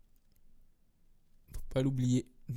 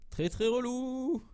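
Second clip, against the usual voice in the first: high, slow, whiny complaint about the lag; very high, slow, mock-annoyed whine; 10 semitones higher, slower than usual. Real, not acted. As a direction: drag it out in a much higher, exaggerated moan. Whining about game lag.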